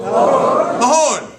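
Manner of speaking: Mahone said loud as "ma-HONE"; Gaelic word. Shown as a demonstration. Teacher calling out Mahone to the crowd, loud and clear. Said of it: "So now I'll teach you".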